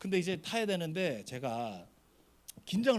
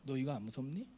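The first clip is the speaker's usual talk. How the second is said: lower, softer, solicitous question; kindly tone; 5 semitones lower, 5 dB quieter than usual. Shown as a demonstration. Pastor demonstrating his question to the small boy, kindly tone. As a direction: show how gently you asked the child. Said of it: "딱 요 톤으로 물었어요".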